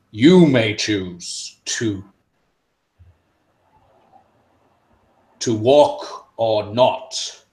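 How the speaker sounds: slow, loud, solemn ruler; slow booming decree. Acted. The mantle telling the champions the choice is theirs, solemn. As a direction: lay down the law gravely. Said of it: "his booming authoritative voice".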